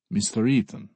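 put-on polite questioning with a Russian accent. A narrator's reading. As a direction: greet him as a courteous Russian. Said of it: "he asks in a Russian accent".

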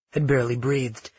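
plain reading voice

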